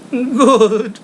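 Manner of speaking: put-on lightly gruff old miser, cutting him off; a hint of the grumpy old man; dismissive old Scrooge, mildly voiced; curt Scrooge.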